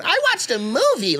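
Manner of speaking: put-on high, sing-song girly reply; mimicked girlfriend. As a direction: ape her cheerful answer, way up high.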